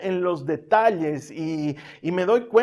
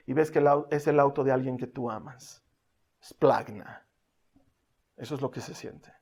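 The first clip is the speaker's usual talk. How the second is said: low, softened, grave storytelling; solemn preaching; 6 semitones lower, 4 dB quieter than usual. Real, not acted. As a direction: let the voice sink with the grief. Preacher hushes at tragic climax. (predicación solemne, voz baja y grave)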